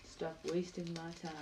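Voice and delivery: very soft echo of a film monologue; understated movie-line mimicry; Morgan Freeman. Echoing Morgan Freeman's film lines.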